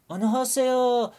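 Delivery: high, fast Korean greeting; Korean mom answering. Retold or imitated. Korean mom picking up the phone at two AM, high and quick. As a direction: chirp it like a startled Korean mother.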